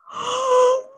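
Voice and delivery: sharp high gasp of outrage; shocked high gasp. Retold or imitated. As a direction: gasp as if insulted. Outraged at the beautician's question.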